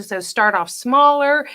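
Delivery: usual speaking voice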